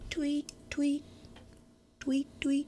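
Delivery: tiny, high, soft bird chirps; high-pitched mock birdsong with gaps; imitated canary tweets. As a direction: chirp softly like a little canary. Imitating canary after naming islands.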